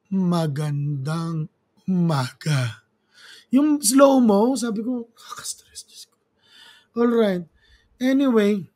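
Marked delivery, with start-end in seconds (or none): 0.1-2.9 put-on low, very slow old-time radio announcer. Vlogger mimicking an old radio announcer, very slow and low. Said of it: "yung radio announcer ... kung magsisalita eh". 5.1-6.2 breathy sigh of frustration under the breath; exasperated whisper. Retold or imitated. Exasperated aside while watching debate.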